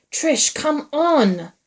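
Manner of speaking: high, loud, drawn-out call; shouted. A narrator's reading. Man urging her through the darkness, shouted. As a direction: shout to her, stretching every word. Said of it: "Wyatt shouted back".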